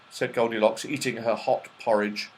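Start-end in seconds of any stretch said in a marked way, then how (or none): none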